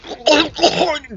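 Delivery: sputtering choke on a drink; choking cough. Acted. Town drunk chokes on drink.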